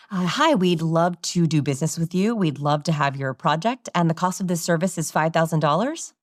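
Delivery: DOLLARS rising like a question; asking the number. Shown as a demonstration. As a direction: pitch smoothly, then lift FIVE THOUSAND DOLLARS as if asking. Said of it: "and they ask their number".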